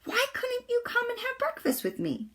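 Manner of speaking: put-on high, bright girl's voice; Pippi. A narrator's reading. Pippi's voice in the story.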